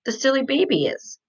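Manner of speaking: ordinary delivery, nothing marked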